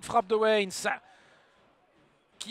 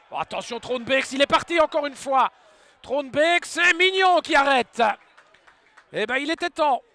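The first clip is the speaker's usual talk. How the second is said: excited sports commentary; 3 semitones higher, 9 dB louder than usual. Real, not acted. Breakaway chance, loud commentary.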